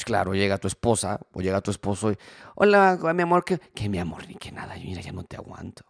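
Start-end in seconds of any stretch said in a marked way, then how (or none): 2.5-3.6 mock sugary hello, higher and a bit louder; sweet greeting. Spouse greeting the other at home, mock sweet. 3.7-5.8 much softer, whispered bitter retort. Retold or imitated. Bitter spouse muttering back at the partner, whispered.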